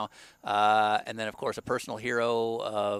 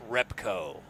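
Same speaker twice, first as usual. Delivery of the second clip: monster truck ad; 4 semitones lower than usual. Pretend. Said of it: "Monster truck voice".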